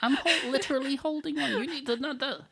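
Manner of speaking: lightly put-on French accent, pitched high; pushy vendor in a mock French accent. Mimicking a pushy Paris vendor in a high, mock French accent. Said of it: "that was my French accent".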